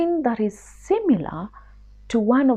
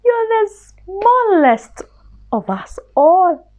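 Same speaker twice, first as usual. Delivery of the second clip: higher, slow teasing mouse voices; mice teasing; 9 semitones higher, 6 dB louder, slower than usual. Acted. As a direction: mice mocking their small friend. Other mice tease.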